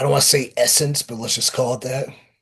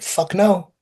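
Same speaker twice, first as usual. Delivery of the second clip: higher-pitched retold refusal; mock blunt denial; 8 semitones higher, 3 dB louder than usual. Wrestler retelling his reply to an old acquaintance, blunt denial. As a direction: blurt it as a comic comeback.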